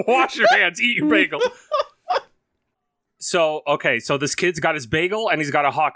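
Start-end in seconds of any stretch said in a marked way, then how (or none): 0.0-1.4 giggling through the boss's line; laughing while quoting. Retold or imitated. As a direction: repeat it, cracking up more each time. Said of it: "I'm laughing to myself".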